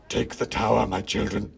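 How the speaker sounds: rough, loud villain command; rally the troops as a villain; understated villainous scream; Mouse King screaming. A narrator's reading. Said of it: "screamed the Mouse King".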